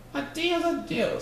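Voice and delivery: high, sharp, curt bug voice; snapping bug. A narrator's reading. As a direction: snap it like an impatient little boss. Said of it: "snapped the bug".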